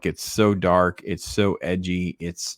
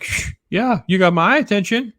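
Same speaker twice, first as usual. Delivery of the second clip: excited fan; 12 semitones higher, 4 dB louder than usual. Retold or imitated. Reader answers the comic's question.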